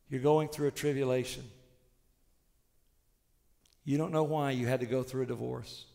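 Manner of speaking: usual speaking voice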